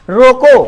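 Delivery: रोको loud and rising, then stop; pause after रोको. Shown as a demonstration. Teacher showing students the comma stop, रोको loud then paused. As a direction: punch रोको and halt at the comma. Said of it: "comma है तो रुकना पड़ेगा".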